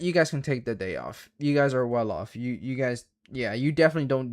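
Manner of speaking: normal talking voice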